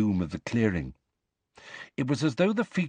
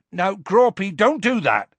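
high, loud scolding shout; raised, high-pitched reprimand; shouted warning; 9 semitones higher, 5 dB louder than usual. A narrator's reading. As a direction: shout a telling-off up at a giant. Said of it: "shouted Hagrid".

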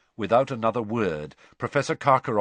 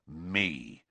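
low, soft and grim; 5 semitones lower, 7 dB quieter than usual. A narrator's reading. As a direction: answer grimly, giving nothing away. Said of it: "said Moody grimly".

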